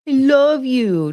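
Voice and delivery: high, slow, loud coo; adoring pet talk. Real, not acted. Owner telling the dog she loves it, cooing.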